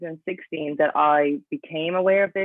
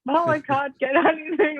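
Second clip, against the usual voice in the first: much higher-pitched mock wail through laughter; mock crying panic; 12 semitones higher than usual. Athlete retelling a coffee-less call to mom, mock crying panic. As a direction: imitate yourself freaking out, half laughing.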